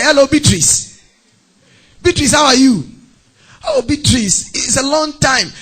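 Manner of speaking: high, loud put-on delight; hammed-up happy hello; effusive phone greeting. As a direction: gush at a long-lost friend, acting. Acted gushing phone greeting.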